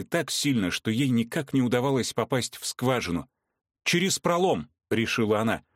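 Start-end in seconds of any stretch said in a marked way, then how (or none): 3.8-4.7 higher, urgent command; urgent call. A narrator's reading. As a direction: call it out in a hurry. Anarina decides, urgent.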